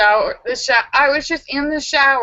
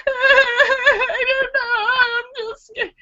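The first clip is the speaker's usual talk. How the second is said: high, very slow, laughing through tears; tearful scared reply; slower than usual. Retold or imitated. Answering her own question, scared and half-laughing. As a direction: whimper it like a frightened child. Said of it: "And I'm like".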